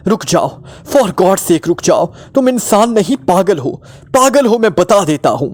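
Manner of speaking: high, frantic pleading cry; hysterical, high-pitched outburst; hysterical scream. A narrator's reading. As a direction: cry out in panic at a madman. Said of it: "हिसियानी अंदाज में चीखते हुए कहा".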